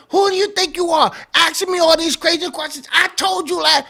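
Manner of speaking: high, fast indignant rant; mock yelling. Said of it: "start yelling".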